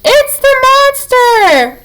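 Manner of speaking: high, slow panicked screech. A narrator's reading. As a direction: screech it out in alarm.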